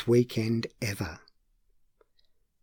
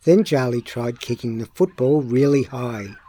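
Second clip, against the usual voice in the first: plain reading voice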